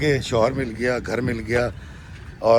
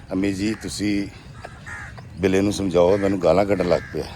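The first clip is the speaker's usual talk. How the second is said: in Punjabi; 4 semitones lower than usual. Retold or imitated. Wife pleads with her mother-in-law in Punjabi. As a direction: say "امی جی، تسی بلے نو سمجھاؤ" in plain Punjabi. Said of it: "یعنی، امی جی".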